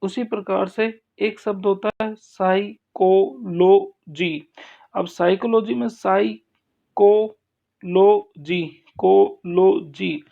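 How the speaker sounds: slow, emphatic teacher's drill; stress demo. Shown as a demonstration. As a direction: stretch psychology and कोलॉजी, leaning on को. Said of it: "तीसरे पे आप stress लगा सकते हैं".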